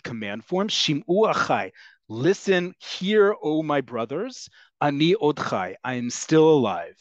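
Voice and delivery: usual speaking voice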